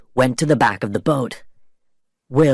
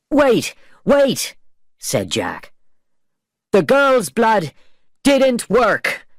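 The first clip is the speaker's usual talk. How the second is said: high pleading Jack; 10 semitones higher, slower than usual. A narrator's reading. Jack stalls Barbosa.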